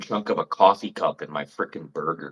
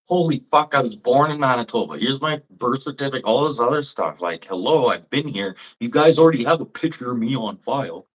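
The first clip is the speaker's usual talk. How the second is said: higher and louder, fed up; exasperated rant; 6 semitones higher, 3 dB louder than usual. Real, not acted. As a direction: vent at the office that ignores your papers. Ranting about license bureaucracy.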